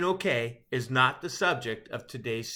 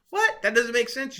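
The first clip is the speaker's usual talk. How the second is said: mock disbelief; 9 semitones higher, 5 dB louder than usual. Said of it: "you say".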